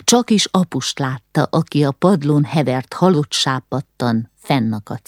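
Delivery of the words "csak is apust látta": usual speaking voice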